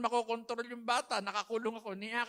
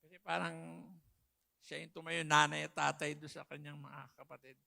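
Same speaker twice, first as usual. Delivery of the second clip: real tears, lower and softer; choked up; 6 semitones lower, 3 dB quieter than usual. Real, not acted. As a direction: hold back tears remembering the eldest son. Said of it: "naiiyak ako pag naalala ko".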